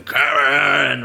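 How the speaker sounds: mock monster roar of a name. Furnace monster calling the boy in the basement, mock roar. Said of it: "monster with this gaping maw going".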